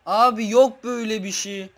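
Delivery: high, loud shout of disbelief; disbelieving shout. Real, not acted. Gamer shouting at viewers in disbelief after failing, loud. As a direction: yell that this can't be happening.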